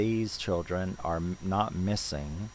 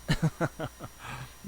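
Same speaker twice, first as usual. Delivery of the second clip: short laugh; 8 semitones higher than usual. Real, not acted. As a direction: breathe out a quick little laugh. Laugh after the realization.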